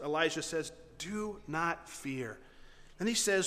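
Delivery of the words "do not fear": soft, very slow, word by word; calm. Acted. Prophet to his freaked-out servant, calm. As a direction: reassure with measured patience. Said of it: "Elisha calmly responds".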